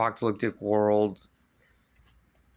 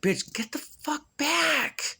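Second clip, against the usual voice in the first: yelling at screen; much slower than usual. Retold or imitated. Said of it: "I'm just like".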